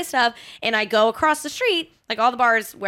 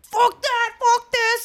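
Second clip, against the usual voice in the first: much higher, mocking angry outburst; imitated meltdown; 12 semitones higher, much slower than usual. Date cursing over the boss's call, mock rage. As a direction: parody him cursing in a rage. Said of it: "You're having a meltdown right now".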